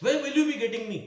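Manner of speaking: called out loud and high, as if across a room; calling out to Ramesh. Shown as a demonstration. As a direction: call the question to Ramesh from afar. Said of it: "इस तरह से कि रमेश".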